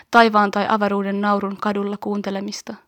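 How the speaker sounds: ordinary talk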